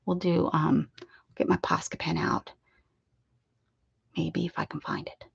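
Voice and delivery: soft unvoiced whisper. Real, not acted. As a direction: whisper it under your breath. Whispering while drawing.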